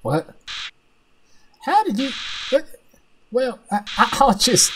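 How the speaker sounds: baffled stammer, jumping up in pitch; confused villain. Acted. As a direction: stammer in growing disbelief. Villain's powers suddenly fail.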